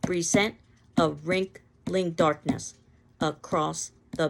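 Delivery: slow, beat-heavy scanned reading. Shown as a demonstration. Teacher demonstrates the scanned beat for students, slow and rhythmic. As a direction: tap out the meter on each stressed syllable. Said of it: "it would sound something like this".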